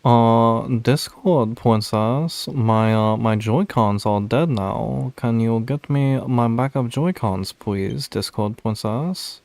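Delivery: loud, drawn-out princess voice; sweet whiny princess plea; Discord princess voice. Acted. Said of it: "doing the Discord princess voice".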